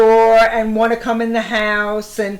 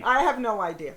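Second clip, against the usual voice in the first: ordinary talk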